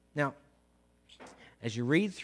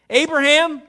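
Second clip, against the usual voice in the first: loud urgent call from above; 5 semitones higher, 8 dB louder than usual. Acted. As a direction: call the name out loud to stop him. Angel calls out to Abraham.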